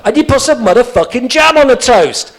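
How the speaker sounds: loud, high, furious shout; fed-up listener shouting at the old man; exasperated outburst. Retold or imitated. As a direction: lose patience and yell it.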